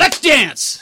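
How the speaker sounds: loud, high mock ref shout; referee's call. Shown as a demonstration. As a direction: shout the fighters into action. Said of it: "I would say".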